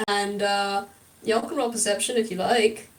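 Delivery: normal talking voice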